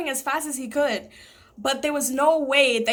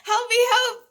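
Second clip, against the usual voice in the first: mock cry for help; 9 semitones higher than usual. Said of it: "I was screaming".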